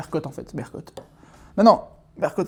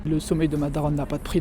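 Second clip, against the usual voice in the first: ordinary talk, nothing marked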